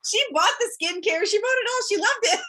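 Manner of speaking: high and loud, laughing as she brags; triumphant. Real, not acted. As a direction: crow the happy ending with a laugh. Triumphant punchline of the story.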